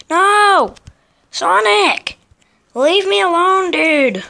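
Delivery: high, loud protest at an attacker; exasperated yelling. Real, not acted. Player shouting at Sonic who keeps attacking, exasperated yell. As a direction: yell at them to back off.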